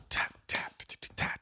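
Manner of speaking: soft whispered tap tap imitating drums. Shown as a demonstration. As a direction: tap it out under your breath like a drum. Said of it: "It's gonna be do it".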